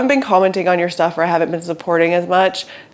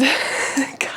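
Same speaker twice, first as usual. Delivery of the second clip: silent airy laugh; soft breathy laugh; breathless wheeze laugh; 7 semitones higher, 6 dB quieter than usual. Real, not acted. Recalling the boxes.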